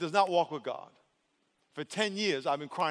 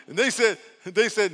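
ordinary talk

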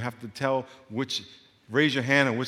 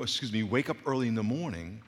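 ordinary delivery

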